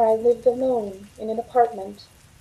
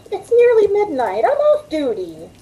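higher, louder objection; exasperated protest; 8 semitones higher, 3 dB louder than usual. Acted. Waitress protests to coworker that her shift is over, exasperated. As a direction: push back, it's late. Refusing, off duty.

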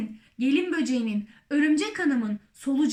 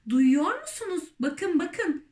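mildly raised, higher voice; excited shout; 5 semitones higher than usual. A narrator's reading. All the creatures crying out at once, mild shout. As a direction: call out urgently, pitched up. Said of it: "diye bağırdılar hepsi birden".

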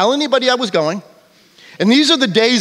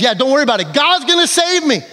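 high, sure of himself, brushing off help; the stubborn believer turning down rescue; confident believer; 7 semitones higher than usual. Acted. Man in the boat waves off the rescuers, high and cocksure.

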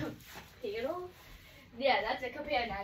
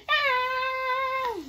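long high-pitched scream; gleeful mock scream at full voice; playful scream; 13 semitones higher, 6 dB louder than usual. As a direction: let out a loud, high, drawn-out yell. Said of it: "That's why I'm screaming".

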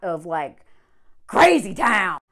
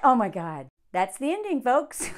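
ordinary talk, nothing marked

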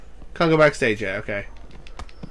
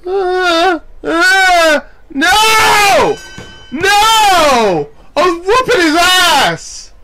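high loud wailing protest; distressed yelling; 14 semitones higher, 6 dB louder, much slower than usual. Real, not acted. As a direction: cry out as victory slips away. Losing after winning, cries out.

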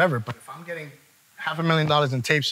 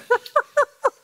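high laugh; 4 dB louder than usual. Real, not acted. Host laughs at being called bad.

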